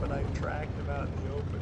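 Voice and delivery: normal talking voice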